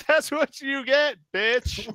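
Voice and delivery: loud, slow gloating through a laugh. Real, not acted. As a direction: taunt while laughing.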